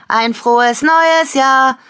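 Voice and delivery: high, slow, loud call; drawn-out festive shout; called-out greeting. A narrator's reading. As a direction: call it across a garden. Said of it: "rief Lena".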